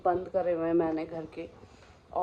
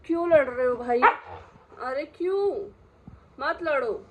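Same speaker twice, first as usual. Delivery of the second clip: higher, louder exasperated chiding; scolding dogs; 6 semitones higher, 4 dB louder than usual. Real, not acted. To the two fighting dogs, exasperated scolding then coaxing. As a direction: break up the fight, then coax.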